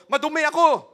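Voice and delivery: high, loud mock cry of an outcast; a hammed-up cry of unclean; leper's warning cry. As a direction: warn people off as the leper. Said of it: "sabihin mo".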